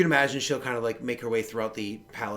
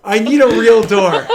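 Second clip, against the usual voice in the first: relieved laughter; 8 semitones higher, 15 dB louder than usual. Real, not acted. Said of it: "that scared the shit out of me".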